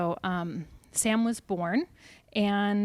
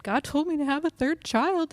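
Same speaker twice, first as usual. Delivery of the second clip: teary; 8 semitones higher than usual. Retold or imitated. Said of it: "I was teary".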